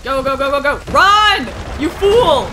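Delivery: loud high yell; mock-dramatic yell at yourself; panicked shout. Real, not acted. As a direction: scream the order to flee. Chase in horror game.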